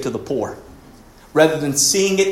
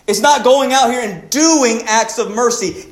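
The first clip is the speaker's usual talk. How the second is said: raised, emphatic sermon delivery; preaching at full force; 8 semitones higher, 4 dB louder, slower than usual. Acted. Preacher urging the church to be loving, emphatic. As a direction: plead with them to change, slowly. Preaches being over doing.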